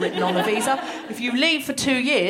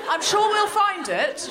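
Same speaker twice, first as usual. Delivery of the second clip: breezy clerk; 9 semitones higher, 3 dB louder, slower than usual. Retold or imitated. Quoting breezy Home Office clerk.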